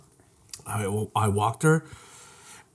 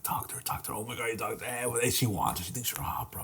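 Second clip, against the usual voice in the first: very soft, half-whispered urging; imitated conspiratorial murmuring; retold hushed egging-on; 3 semitones lower, 14 dB quieter than usual. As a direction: nudge a buddy under your breath. Retold gym friends urging him on.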